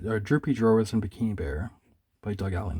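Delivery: ordinary talk, nothing marked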